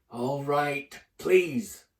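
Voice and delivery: loud, through-gritted please; grudging caretaker. Acted. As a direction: force the word out. Filch forced.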